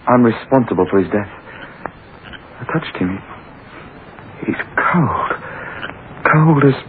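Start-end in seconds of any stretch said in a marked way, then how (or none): 2.6-6.8 trembling horror at the cold body; horrified. Acted. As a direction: recoil in dread. Horrified young man.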